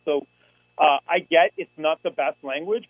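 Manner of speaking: ordinary delivery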